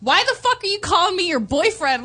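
mock furious snap at her; hammed-up angry boyfriend yelling; retold angry phone rant. Boyfriend yelling at her on the phone, mock angry. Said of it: "got on the phone with her".

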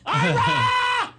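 shrill high scream of a name; mock terrified scream; screaming for her husband. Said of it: "she starts screaming".

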